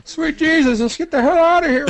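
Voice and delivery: very high, loud mock panic; screaming announcer. Announcer flees the armed bomb, mock scream. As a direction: squeal it like a terrified announcer. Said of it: "have the announcer scream".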